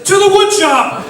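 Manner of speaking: high, loud call to charge; excited battle cry; rallying cry. Retold or imitated. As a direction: lead the charge. Coworker rallies the group.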